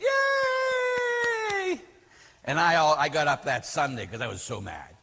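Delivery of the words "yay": very high, long-held mock cheer; cheering nuns. All the nuns answering the speech, mock cheering. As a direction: squeal a crowd's yay in imitation, without much volume. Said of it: "And all the nuns went".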